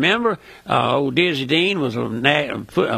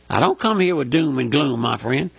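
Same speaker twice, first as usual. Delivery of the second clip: ordinary talk, nothing marked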